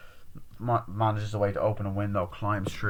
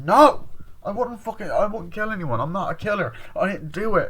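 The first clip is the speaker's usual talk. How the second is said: loud, high-pitched acted outburst; desperate denial; 10 semitones higher, 6 dB louder than usual. Accused killer told to drop the gun, desperate loud denial. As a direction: open on a shouted refusal, keep protesting high.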